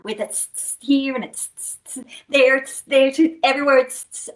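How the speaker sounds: hissing tss tss; snake hiss. Shown as a demonstration. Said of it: "What's your snake sound?".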